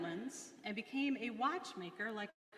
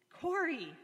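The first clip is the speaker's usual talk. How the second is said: high, louder eager call; excitedly; 8 semitones higher, 5 dB louder than usual. A narrator's reading. Betsy calls to Corrie with sudden excitement. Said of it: "she said excitedly".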